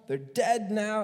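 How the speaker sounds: normal talking voice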